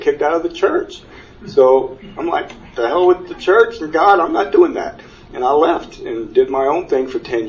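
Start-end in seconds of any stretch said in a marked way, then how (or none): 2.7-5.0 retold anger. Angry after being turned away.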